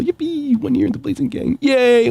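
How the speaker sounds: high, bright squeal of joy; yippee cheer. Real, not acted. Celebrating a fan's anniversary.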